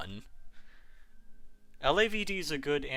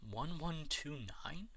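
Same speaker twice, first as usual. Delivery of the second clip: whispered; 10 dB quieter, slower than usual. Real, not acted. Reading out a chat request.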